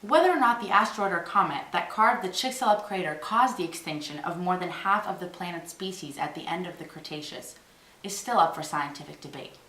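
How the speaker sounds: ordinary delivery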